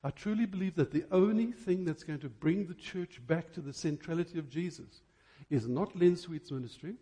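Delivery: normal talking voice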